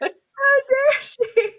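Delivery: high, very slow mock outrage. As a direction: clutch your pearls, laughing. Mock outrage while laughing.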